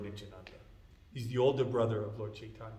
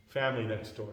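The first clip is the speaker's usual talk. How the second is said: plain reading voice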